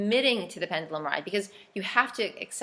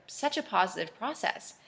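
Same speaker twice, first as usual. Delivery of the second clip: usual speaking voice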